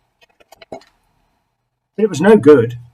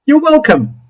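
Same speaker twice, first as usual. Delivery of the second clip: cheery creature; 11 semitones higher than usual. Acted. Creature greets Something Else at his door, high and cheery. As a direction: bounce in like an overfriendly little creature.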